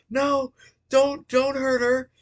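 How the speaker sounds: mock child crying don't; pleading child. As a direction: squeak it out like a scared kid begging. Kid begs Bonnie to stop.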